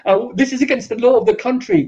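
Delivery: high, fast indignant protest; mock indignant whine. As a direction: squeal it with mock outrage. Mocked victims calling police.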